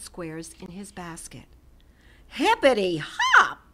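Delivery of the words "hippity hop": loud, slow, sing-song hippity hop; happy hop cheer. A narrator's reading. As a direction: cheer it out with a bounce. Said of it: "Hoppy said".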